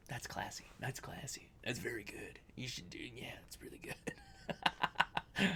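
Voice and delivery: hushed whispered praise; soft mock-reverent whisper. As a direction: whisper it like a secret compliment. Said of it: "I'm like".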